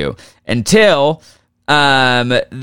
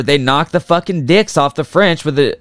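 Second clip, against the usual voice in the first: ordinary talk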